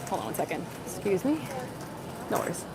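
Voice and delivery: brief hushed aside to someone nearby; quiet aside. Real, not acted.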